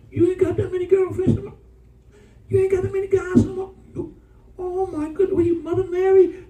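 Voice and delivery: put-on high, fast gushing voice of an old flame; mock gushing old flame. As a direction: gush over them like a nosy ex. Imitating gossiping old flames.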